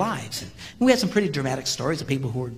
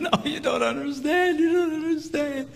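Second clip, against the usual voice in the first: much higher, pleading insistence; desperate protest; 11 semitones higher than usual. Retold or imitated. Hitman to the counselor, high pleading protest. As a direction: plead to be understood. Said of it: "he's going".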